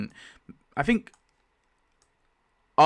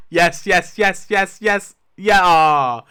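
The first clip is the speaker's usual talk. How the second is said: loud, high repeated cheers with laughter; elated cheering; 6 semitones higher, 9 dB louder, slower than usual. Real, not acted. Player celebrating who gets to kill Laurie, elated. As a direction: celebrate in a real, gleeful outburst.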